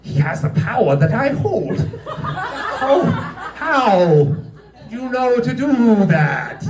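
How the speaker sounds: high hammed-up horror; drawn-out, high mock dismay; scared, astonished boss. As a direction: wail it like a villain robbed of his power. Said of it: "that scared him off the floor".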